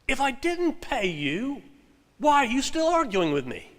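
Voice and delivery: a hint of a fussy British client pouncing; indignant client. The client catches the arguer out.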